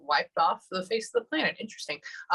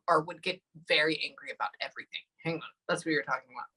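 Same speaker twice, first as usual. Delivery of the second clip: normal talking voice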